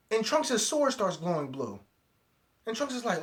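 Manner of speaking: usual speaking voice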